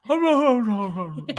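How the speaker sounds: protesting no. Real, not acted. Dog does it again.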